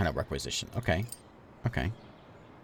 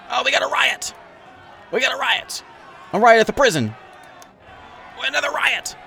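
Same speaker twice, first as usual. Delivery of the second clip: excited shouting; 4 dB louder than usual. Real, not acted. Gleeful at riots in game.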